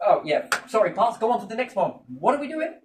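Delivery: normal talking voice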